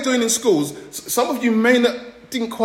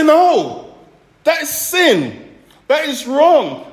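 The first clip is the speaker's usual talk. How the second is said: higher and louder, slow and emphatic; firm loud refusal; 7 semitones higher, 3 dB louder, much slower than usual. Pretend. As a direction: plant your feet and declare it wrong, slowly.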